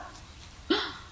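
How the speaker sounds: sharp high breathless gasp; startled gasp. Acted. Narrator gasping at the fur for the listener, a startled gasp. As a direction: gasp as if you just saw the fur.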